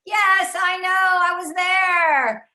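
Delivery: mock yell of a fed-up mother; retold scream. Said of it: "my mother screamed out".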